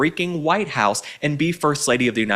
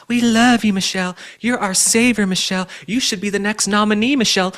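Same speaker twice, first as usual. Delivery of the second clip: high, loud gushing adoration; mocking the media's fawning praise; mock gushing fan; 8 semitones higher, 3 dB louder than usual. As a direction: sing it out like a starstruck fan. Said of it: "the same people... were telling us".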